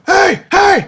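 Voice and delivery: high, loud shout to get attention. Retold or imitated. Dad shouting to get his kids' attention, loud call. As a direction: bellow it like calling kids. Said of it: "But if I'm like".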